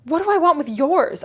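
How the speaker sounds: exasperated gentleman retort; sharp retort. Acted. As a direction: snap back in disbelief. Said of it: "I retorted".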